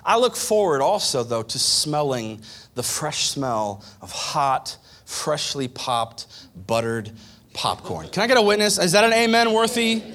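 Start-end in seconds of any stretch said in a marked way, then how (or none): 1.2-8.1 savoring. Acted. Savoring popcorn smell, drawn out. 8.1-10.0 call for amen. Real, not acted. Calling out to congregation.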